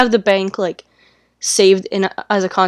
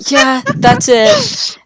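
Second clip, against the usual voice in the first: high, drawn-out imitation of being winded; retold breathlessness; 4 dB louder, much slower than usual. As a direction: act out answering after running the stairs. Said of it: "I'm so out of breath".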